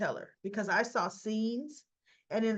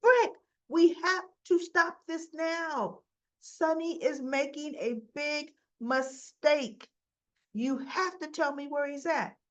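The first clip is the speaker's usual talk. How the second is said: urgent plea; 7 semitones higher, 4 dB louder than usual. Retold or imitated. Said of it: "And she goes".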